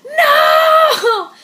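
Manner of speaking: high, loud drawn-out "no"; mock anguished scream. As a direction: wail it out, imitating mom's outburst. Mom's outburst over the book retold.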